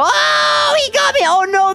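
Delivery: very high drawn-out cry; alarmed shriek. Real, not acted. Kid caught by enemy in sewers, alarmed shriek. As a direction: shriek as you get caught.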